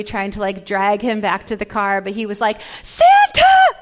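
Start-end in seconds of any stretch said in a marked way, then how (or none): 2.8-3.7 put-on high, loud shouted child voice; excited little boy. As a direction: call out like a kid dragged away from Santa. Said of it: "So excited to see Santa".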